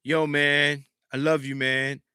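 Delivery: soft, quiet, heartfelt "I love you"; tender quote. Retold or imitated. To his best friend, a soft, sincere goodbye. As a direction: say it gently to your best friend. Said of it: "you told him".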